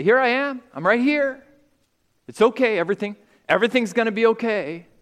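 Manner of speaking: high, loud soothing call from the other room; reassuring parent. Retold or imitated. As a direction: comfort the frightened child right away. Parent answering a scared child.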